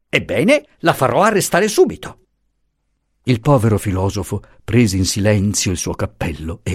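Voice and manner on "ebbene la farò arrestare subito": higher, scornful official; lightly voiced insolent commissioner. A narrator's reading. Commissioner scoffs at the professor's story, mildly scornful. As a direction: dismiss him with contempt.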